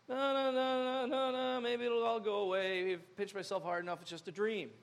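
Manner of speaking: mock frozen freak-out; catatonic denial. Freaker outer wishing it all away, catatonic denial. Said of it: "wanna lock up, go catatonic".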